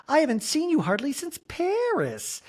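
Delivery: very high, sing-song greeting; imitating a high breathy Jennifer Tilly. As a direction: coo it in a Jennifer Tilly squeak. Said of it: "Jennifer's like".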